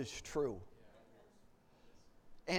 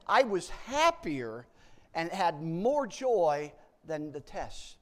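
slow, louder testimony; slow emphatic; 2 semitones higher, 8 dB louder, much slower than usual. Real, not acted. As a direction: drag each word out for weight. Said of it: "emphatic slow testimony".

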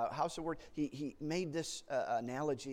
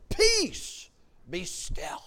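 a ringing high command on Peace, then settling; calm the storm with one word; loud, commanding call that drops to stillness; commanding Jesus; 7 semitones higher, 10 dB louder, much slower than usual. Retold or imitated. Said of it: "he said".

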